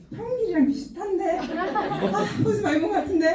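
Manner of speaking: high-pitched, half-laughing double take; playful imitation of puzzled recognition; puzzled passer-by. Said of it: "하면서 지나가는 거예요".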